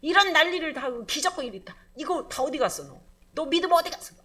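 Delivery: loud, high rebuke; a reproachful voice shaming a weary believer. Acted. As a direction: demand where his faith went. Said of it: "이렇게 책망이 아니라".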